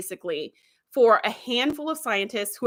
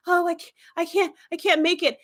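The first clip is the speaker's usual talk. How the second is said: gasping; 6 semitones higher than usual. Retold or imitated. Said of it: "he starts gasping, like he can't breathe".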